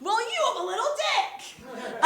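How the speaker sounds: high mocking comeback, half laughing; high snarky retort; retold comeback. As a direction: fire back the insult with a grin. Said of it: "And I said".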